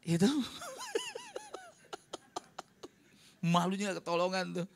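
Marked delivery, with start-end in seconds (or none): 0.3-1.6 airy high-pitched giggle; high laugh. Real, not acted. Preacher laughing at his own punchline, high giggle.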